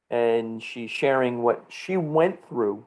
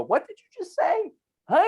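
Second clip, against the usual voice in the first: much higher, incredulous put-on voice; mock disbelief. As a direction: play stunned on the phone, pitched way up. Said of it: "I'm on the phone with her and I go".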